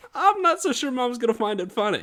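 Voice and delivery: acted-out wary son; doubtful protest. Acts out his doubt to father.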